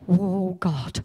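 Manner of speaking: a hint of anxious doubt; mild worried doubt. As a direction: wonder uneasily if it's really God. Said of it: "It's a fear of".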